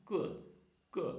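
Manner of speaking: "q" as a short "kuh"; letter sound q. Shown as a demonstration. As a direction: say "q" as its sound, not "cue". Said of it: "Q-ൻ്റെ pronunciation".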